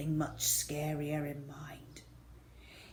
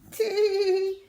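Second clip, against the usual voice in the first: gleeful snicker; 14 semitones higher, 6 dB louder than usual. Acted. Henry gloating.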